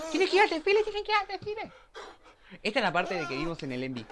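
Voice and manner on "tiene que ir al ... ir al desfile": excited squeal. Real, not acted. Laughing excited reaction to show.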